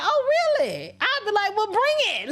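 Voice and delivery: high, defiant bring-it challenge; defiant dare. Retold or imitated. Telling her audience how to answer oppressors, high and defiant. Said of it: "You was supposed to be like".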